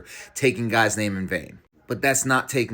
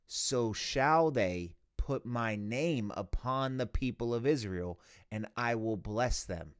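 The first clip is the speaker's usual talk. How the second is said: scripture reading; 6 dB quieter than usual. Acted. Reads priestly blessing aloud.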